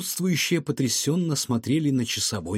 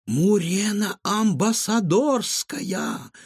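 high, slow astonished gasp of a name; gasping recognition; 7 semitones higher, much slower than usual. A narrator's reading. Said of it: "ахнула Илона". (Илона ахает, узнав Мурену)

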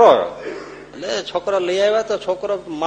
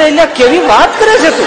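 incredulous outburst; 12 semitones higher, 11 dB louder than usual. Retold or imitated. Incredulous at the request.

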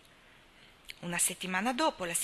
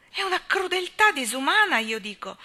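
much higher and louder, outraged; highly agitated; 9 semitones higher, 10 dB louder than usual. A narrator's reading. Miss Cornelia protesting to Anna, at the height of agitation. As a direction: cry out in outrage. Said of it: "al colmo dell'agitazione".

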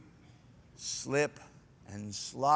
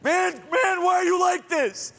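high shouted whine; shouting complainer; 13 semitones higher, 5 dB louder than usual. Retold or imitated. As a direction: wail it at the friend. Acted whiny complainer quarrel.

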